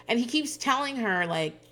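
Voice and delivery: normal talking voice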